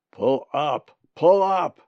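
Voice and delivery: loud shout, rising on the repeat; urgent warning. A narrator's reading. A voice warns Colin as he falls, urgent shout. Remembered voice warns falling bird.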